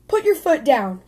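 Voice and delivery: ordinary delivery, nothing marked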